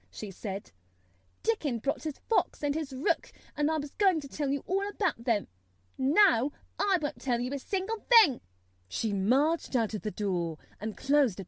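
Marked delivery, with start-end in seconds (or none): 1.4-8.4 high, heated, building to loud; spiteful. A narrator's reading. Mary at the door punishing Colin, spiteful. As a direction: withhold it to punish him.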